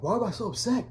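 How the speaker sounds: a hint of an upset father echoing his son; retold upset dad. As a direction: throw his question back at him, stung. Dad's retort in confession story.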